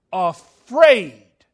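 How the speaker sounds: booming reveal; dramatic emphasis. Acted. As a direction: punch the word afraid. Dramatic reveal of the disciples' fear.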